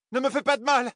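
very high pleading cry; fluttering cries for help. A narrator's reading. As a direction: feign terror, begging. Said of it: "cria-t-elle".

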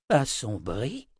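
puzzled echo with a rising end; worried query. A narrator's reading. Old wizard hears her vision darkened, mildly alarmed. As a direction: echo the word as a concerned question. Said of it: "Inquiet, le vieil homme".

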